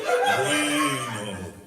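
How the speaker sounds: savouring Spanish Bueno, then muy bueno; lightly mock-Spanish, relishing Bueno; Spanish praise. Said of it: "new language".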